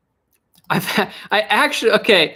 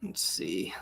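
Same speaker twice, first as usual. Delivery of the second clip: unvoiced little whisper to self; 11 semitones lower, 16 dB quieter than usual. Real, not acted. Streamer whispering while searching quotes.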